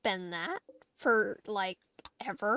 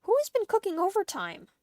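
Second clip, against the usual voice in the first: shocked disbelief; 4 semitones higher, 5 dB louder than usual. Real, not acted. Shocked at the stockpile of meals.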